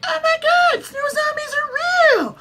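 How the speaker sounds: very high squeal, slow on the end; mock Cersei shock. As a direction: shriek it like a shocked cartoon lady. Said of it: "and she'd be like".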